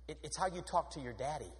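ordinary delivery

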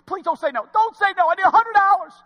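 rising to a loud, high pleading wail; hammed-up desperate plea for cash; mock begging. Customer begging the bank teller, loud hammed-up pleading.

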